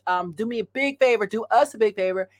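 ordinary talk

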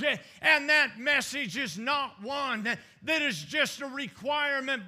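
high, driving sermon delivery; full-force preaching. Acted. Preacher proclaiming Jesus' message to the congregation, full-force preaching. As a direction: punch the phrases with rising fervor.

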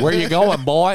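hammed-up Southern movie voice calling out; Southern accent hammed up for friends; mock Southern accent. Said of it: "like a Southern film".

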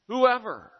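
WHOEVER called out high and loud, falling at the end; emphatic whoever. Acted. Preacher calling whoever to all hearers, emphatic and loud. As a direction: throw Whoever out to the whole congregation. Preacher stressing the gospel's reach.

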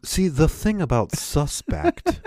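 slow, pompous newsman cadence; Perd Hapley. Retold or imitated. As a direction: deliver the obvious like breaking news. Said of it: "sort of like a Perd Hapley impersonation".

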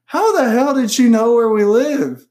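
high, loud, drawn-out disbelief; incredulous outburst. Retold or imitated. Host retelling asking a partner about the address, loudly incredulous. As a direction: demand it in exaggerated astonishment. Retelling her startled reaction.

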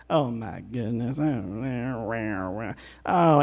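low, soft mock groan of a discouraged soul; mock complaining groan. Pastor voicing a discouraged soul complaining to itself, low mock groan. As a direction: moan it to yourself, half-hearted. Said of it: "start talking to yourself".

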